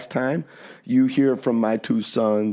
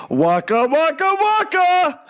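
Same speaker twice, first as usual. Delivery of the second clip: loud, high catchphrase impression, climbing with each repeat; Fozzie Bear; 4 dB louder, slower than usual. Dad doing his Muppet voice for the kids, loud high Fozzie Bear impression. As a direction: belt out a high-pitched comic bear catchphrase. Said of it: "I'll do my Fozzie Bear".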